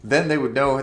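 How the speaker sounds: ordinary talk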